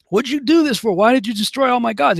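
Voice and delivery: high-pitched outraged father, acted out; storyteller's high, indignant dad; flipping out. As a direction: demand an explanation in a mock freak-out. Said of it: "He's flipping out".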